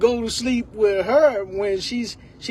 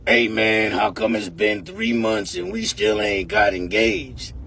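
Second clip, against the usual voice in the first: deep gruff woman; 11 semitones lower than usual. Retold or imitated. Girlfriend complaining about no engagement, deep gruff voice. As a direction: demand it like a tough woman. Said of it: "that woman sounding like that".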